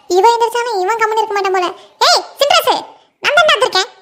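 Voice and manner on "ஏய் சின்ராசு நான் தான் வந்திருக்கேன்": high call to the son. Acted. Mother calling her son. (மகனை உரக்க கூப்பிடுதல்)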